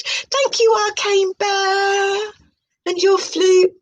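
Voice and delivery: high, airy parody of a mystic presenter; mock spiritual host. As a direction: announce it like a dreamy new-age show host. Mock mystic thanks, chant-like names.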